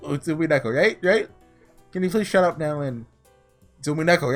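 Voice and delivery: ordinary talk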